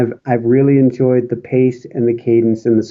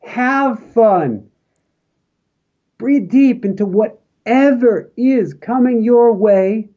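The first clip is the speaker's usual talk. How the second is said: slow send-off blessing; 3 semitones higher, much slower than usual. Acted. Closing blessing to the audience.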